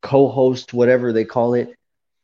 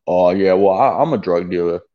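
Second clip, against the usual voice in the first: lower-pitched imitated man's voice; mock drug dealer; 4 semitones lower than usual. Imitating a man admitting his trade in the chat, mock drug dealer. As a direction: drop the pitch to impersonate him casually admitting it. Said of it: "didn't hear this motherfucker say".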